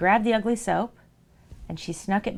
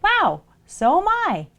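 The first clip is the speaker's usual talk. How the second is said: high, slow, wide-eyed soap voice; a slow, amazed little voice up high; ugly soap voice; 11 semitones higher, 5 dB louder, slower than usual. Acted. Mother tells soap story, ugly soap answers in high slow wonder.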